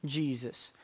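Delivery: hushed answer. Acted. Call-and-answer, answering own question.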